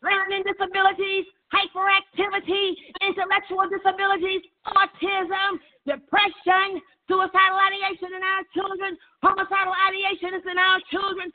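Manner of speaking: high, sustained preacher's chant on one note; intoned prayer list. Real, not acted. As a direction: call out each affliction on a held high pitch.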